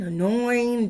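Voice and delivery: plain reading voice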